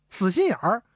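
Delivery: ordinary delivery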